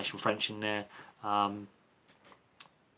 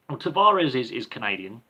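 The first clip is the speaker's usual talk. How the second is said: usual speaking voice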